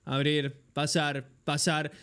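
low, flat-toned repetition of clicks; bored droning. Retold or imitated. Imitating himself clicking alone in silence, low and bored. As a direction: drone the words low like a bored zombie. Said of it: "Yo estaba así en silencio".

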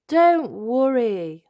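drawn-out reassuring dad; Daddy Pig voice. Acted. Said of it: "says Daddy Pig".

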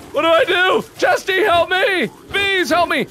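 panicked pleading. Real, not acted. Begging for help, attacked.

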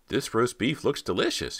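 high, stagy, smiling delivery; hammed-up actor line. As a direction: overplay the happy dad at dinner. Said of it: "smiling down at an empty platter".

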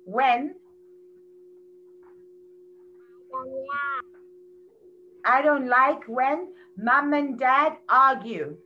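slow model sentence. Shown as a demonstration. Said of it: "We can say".

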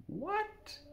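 a startled, high-pitched "What?"; stunned what. Retold or imitated. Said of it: "And I'm like".

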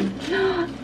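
sharp high gasp of delight; excited gasp. Real, not acted. Unboxing the package she hoped for, high gasp. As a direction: gasp as the box opens.

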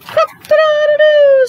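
very high, loud, slow cock-a-doodle-doo; rooster crowing. A narrator's reading. Reading to a child, crowing loudly as the rooster. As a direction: crow it out like a rooster at dawn. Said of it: "said the rooster".

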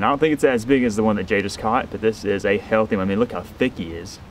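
ordinary delivery

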